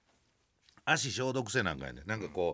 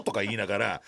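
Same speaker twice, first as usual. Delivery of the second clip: ordinary talk, nothing marked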